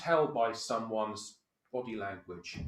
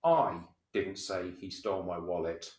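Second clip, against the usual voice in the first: I punched, rest of the line even; stress on I; 4 semitones lower than usual. Shown as a demonstration. Demonstrating to the team, stress on I. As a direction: lean hard on the first word I. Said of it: "if I add intonation to the first word".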